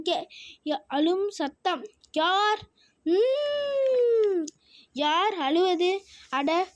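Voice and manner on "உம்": long high drawn-out whimpering hum; crying whimper. Acted. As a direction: sob out a long high hum like a hurt little bird. Crying bird in story.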